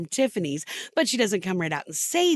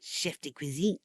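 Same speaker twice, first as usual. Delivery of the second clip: bad French accent; 6 semitones lower, 8 dB quieter than usual. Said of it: "with a very bad French accent".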